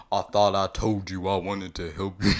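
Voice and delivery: loud put-on scolding, lightly done; playful mock anger. You chiding Shrek about dinner, playfully angry. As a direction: scold a sweetheart in play.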